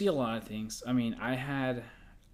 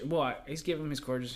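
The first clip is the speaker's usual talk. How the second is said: ordinary talk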